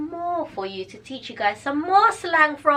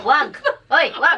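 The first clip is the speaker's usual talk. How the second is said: scolding; 5 semitones higher, 6 dB louder than usual. Shown as a demonstration. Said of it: "try to translate that back to Tagalog".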